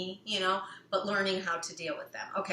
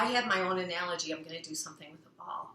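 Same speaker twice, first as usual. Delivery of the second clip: usual speaking voice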